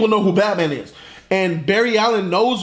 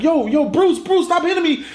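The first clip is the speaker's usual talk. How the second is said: high-pitched urgent plea; pleading protest; 9 semitones higher than usual. Acted. Flash being beaten by Batman, high acted pleading. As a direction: beg high, climbing as you go.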